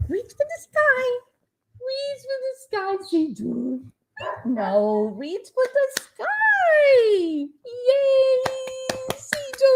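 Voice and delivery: high pet voice calling the dog; talking sweetly to her dog. Real, not acted. Playing with dog, baby voice.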